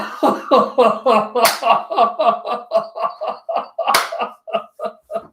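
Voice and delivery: long high-pitched laughing fit; real burst of helpless laughter; big laugh. Real, not acted. Reacting to his own story.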